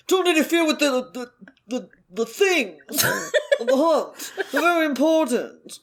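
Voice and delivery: mock a pompous brat; mocking whiny brat. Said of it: "he has no fucking idea".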